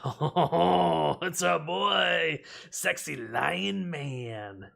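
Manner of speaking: loud, drawn-out fan swoon; playful gushing. Real, not acted. Streamer pulls a favourite card, gushing delight. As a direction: stretch the words out in delighted adoration.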